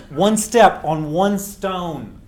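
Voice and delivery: drawn-out, step stressed; slow, let it sink in; solemn weighty refrain; slow emphatic. Real, not acted. Repeat of key phrase.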